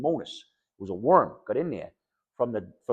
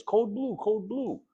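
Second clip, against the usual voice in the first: much higher urgent call; hospital alarm call; 9 semitones higher, much slower than usual. Retold or imitated. Staff calling code blue in the hospital, high and urgent. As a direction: announce an emergency over the ward. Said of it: "my sister hears".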